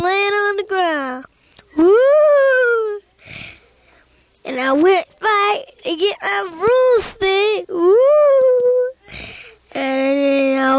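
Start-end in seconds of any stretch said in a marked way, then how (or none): 1.8-3.0 high loud ghostly woo. Acted. Ghost wailing over bones in the graveyard, high and loud. 7.7-8.9 high loud ghostly woo. Acted. Ghost wailing over bones in the graveyard, high and loud.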